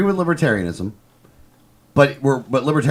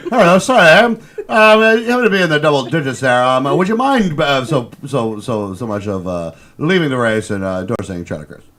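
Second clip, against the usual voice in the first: mock English accent, hesitant and stammering. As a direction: hem and haw apologetically in an English accent. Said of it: "had to have an English accent".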